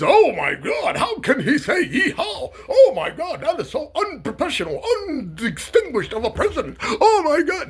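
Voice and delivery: clutch your pearls, shrieking and half laughing; mock outraged Republican. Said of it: "One of the Republicans".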